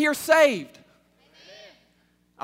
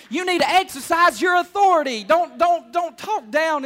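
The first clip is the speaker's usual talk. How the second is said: raised, higher preaching voice; forceful exhortation; 6 semitones higher, 7 dB louder than usual. Real, not acted. Preacher urging church to exercise authority, forceful exhortation. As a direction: press the command home loudly.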